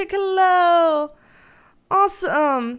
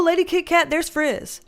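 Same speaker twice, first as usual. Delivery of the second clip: ordinary talk, nothing marked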